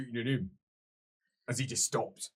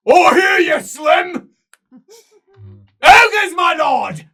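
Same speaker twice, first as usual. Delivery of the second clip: much higher, much louder warning cry; panicked shout; 28 dB louder, much slower than usual. Acted. Servant shouts a warning about the ogres, panicked. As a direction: yell the warning in terror. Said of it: "he shouts".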